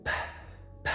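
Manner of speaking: bare P as a puff of air; voiceless burst of breath on P; aspirated P. Shown as a demonstration. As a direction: pop P with audible air. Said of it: "A pronúncia é com aspiração".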